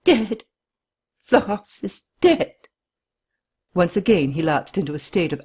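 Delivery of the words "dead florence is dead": stunned. A narrator's reading. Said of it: "with staring eyes and a look of stupor".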